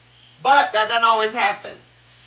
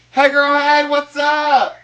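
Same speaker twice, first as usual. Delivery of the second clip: higher, louder happy hello; excited greeting; 6 semitones higher, 4 dB louder, slower than usual. Real, not acted. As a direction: call out to a friend who just joined. Greeting viewer on livestream.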